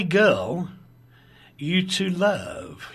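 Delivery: ordinary delivery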